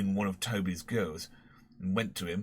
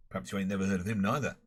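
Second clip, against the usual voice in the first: ordinary delivery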